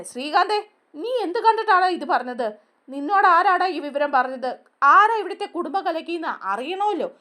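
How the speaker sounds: high, sharp interrogating tone; angry questioning. Retold or imitated. Mother interrogating Sreekanth about the rumour, angry and high. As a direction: grill him on who spread it.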